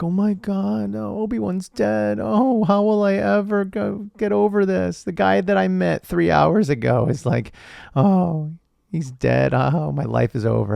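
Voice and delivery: hammed-up self-pity of a whiny hero; whiny Luke. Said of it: "Luke's like".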